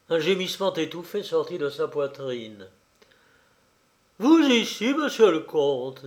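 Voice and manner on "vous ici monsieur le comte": high, loud, bitter challenge; indignant narrated outburst, starting high; scathing bitterness. A narrator's reading. Old farmer confronting the count he banished, scathing bitterness. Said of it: "dit-il avec une amertume sanglante".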